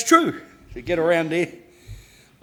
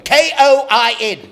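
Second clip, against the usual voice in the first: pupil spelling out loud; 8 semitones higher, 10 dB louder than usual. Retold or imitated. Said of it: "Another one goes".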